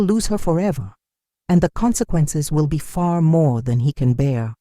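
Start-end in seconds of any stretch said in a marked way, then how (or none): none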